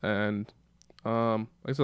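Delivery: normal talking voice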